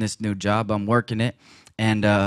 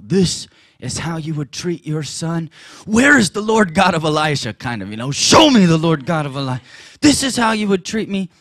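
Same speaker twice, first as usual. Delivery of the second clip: raised, indignant plea to God; demanding cry; 5 semitones higher, 4 dB louder than usual. Retold or imitated. Preacher retelling his angry prayer, raised indignant cry. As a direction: confront God, loud and higher.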